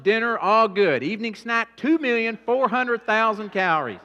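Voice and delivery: loud, high, hammering preacher voice; full-force preaching. Acted. Preacher to congregation about overeating, full-force preaching. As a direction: pound each line at the crowd.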